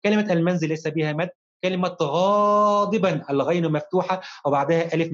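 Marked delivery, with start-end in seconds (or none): 2.0-3.2 "غاضبا" with the first aa held very long; stretched long vowel. Shown as a demonstration. Teacher demonstrates madd to pupil, first aa of "غاضبا" held long. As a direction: draw out the alif after the ghayn in "غاضبا". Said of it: "ففيها مد بالألف".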